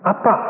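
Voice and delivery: "Appa" with stress on the second syllable; Shanghai dialect pronunciation. Shown as a demonstration. Preacher repeating the Shanghai word for Father, "Appa" end-stressed. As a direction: land on the "pa" of "Appa". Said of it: "emphasis on the second syllable".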